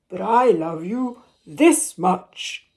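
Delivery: a hint of a proud father; gently voiced big father hare.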